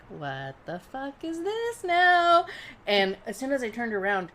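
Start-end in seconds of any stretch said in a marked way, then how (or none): 0.1-2.5 high, slow, drawn-out what the fuck; turning round slowly, hamming up the alarm; mock alarmed snap. Woman felt up from behind by a stranger, high drawn-out alarmed demand. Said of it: "and I was like".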